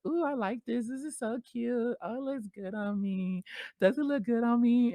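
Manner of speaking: squealy self-admiration; delighted gushing. Retold or imitated. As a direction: gush over yourself in the mirror. Admiring herself in new bra.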